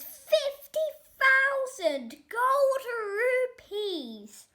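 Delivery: high, loud, slowed in disbelief; astonished, drawn-out exclaim; shocked price. A narrator's reading. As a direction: gasp out the huge sum. Outrageous price.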